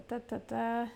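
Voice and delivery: ordinary talk, nothing marked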